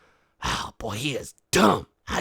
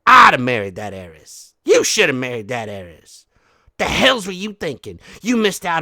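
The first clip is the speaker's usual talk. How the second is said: berating; 8 dB louder than usual. Acted. Berating daughter.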